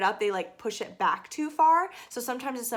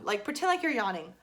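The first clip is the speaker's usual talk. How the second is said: usual speaking voice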